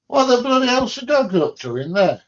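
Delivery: loud, high exasperated call to the next room; calling out at the dog. Real, not acted. Calling out to the dog in the other room, exasperated shout. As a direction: yell at the noisy dog.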